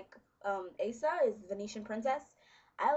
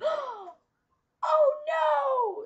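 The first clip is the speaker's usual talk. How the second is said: much higher, louder mock scream of terror; panicked scream; 12 dB louder than usual. Imitating a terrified mom in a home break-in commercial. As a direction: shriek it like a frightened mom in an ad. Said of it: "the mom's like".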